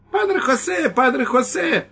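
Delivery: put-on very high, loud children's squeals; squealing children. Children in the yard squealing the priest's name, very high. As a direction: shriek it like excited kids. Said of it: "завизжали дети".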